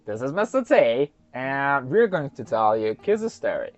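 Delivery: ordinary delivery, nothing marked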